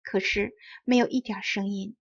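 ordinary delivery

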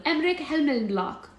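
put-on high German little-boy voice; friendly child with a mock German accent; cute German accent. A narrator's reading. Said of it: "in a cute German accent".

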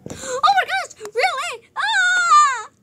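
high-pitched mock freak-out; horrified reaction. Reacting to news that the girl is bleeding, horrified. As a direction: build to a loud, hammed-up scream.

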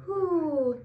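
a light owl hoot, hoo. Retold or imitated. Teacher showing the kids what an owl says. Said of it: "the owls say".

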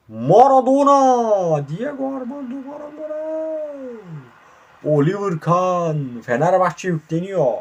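high drawn-out commentator call; commentator shout. Pretend. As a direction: stretch the name out like a goal call. Commentator calls star players. (coşkulu spiker haykırışı)

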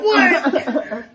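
high, loud, incredulous cry; laughing shock. Retold or imitated. Eavesdropping recruit hears the secret remark, laughing shock. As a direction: blurt it out through laughter.

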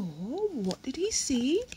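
usual speaking voice

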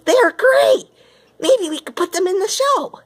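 high, bright eager voice; excited Gonzo. A narrator's reading. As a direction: pitch it up with excitement. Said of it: "said Gonzo excitedly".

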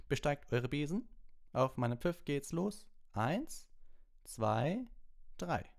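high, clipped start call; brisk referee countdown. A narrator's reading. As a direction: count them off the ground.

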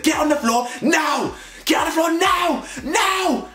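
very high, shouted, slow commands; mock police shout. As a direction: bark the order like an aggressive cop. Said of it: "the way they speak like that".

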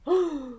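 sharp surprised intake of breath; a storyteller's gasp for the kids; dramatic gasp. Acted. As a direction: gasp as if the story just shocked you. Reader gasps at the story.

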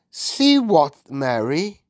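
high, emphatic question; puzzled Queen. A narrator's reading. The Queen asks her maid what she means, puzzled.

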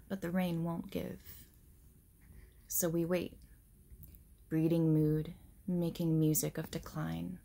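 measured poetry reading with reflective pauses; poem reading. Real, not acted. Reading an autumn poem to viewers, measured poetry recital. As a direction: read it line by line, letting each image settle.

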